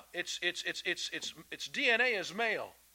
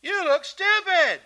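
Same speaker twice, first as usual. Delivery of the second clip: high, slow mocking shout; loud jeering; 13 semitones higher, 12 dB louder, slower than usual. Shown as a demonstration. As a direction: jeer it at full volume, drawn out. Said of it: "a national laughing campaign".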